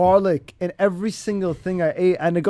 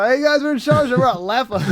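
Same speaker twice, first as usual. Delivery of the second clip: hyped vlogger greeting, higher and louder; vlogger intro voice; 7 semitones higher, 4 dB louder than usual. Retold or imitated. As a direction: open the video like an eager YouTuber. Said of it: "It's like, you go".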